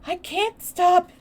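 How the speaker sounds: high, loud distressed wail; anguished, high-pitched cry; gasping sob. A narrator's reading. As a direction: sob it out slowly and helplessly. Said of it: "he gasped and sobbed".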